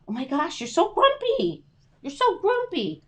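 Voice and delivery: pet talk. Real, not acted. Owner teasing her growling dog in high baby talk. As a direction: coo at the grumpy dog. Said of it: "she's being all grumpy".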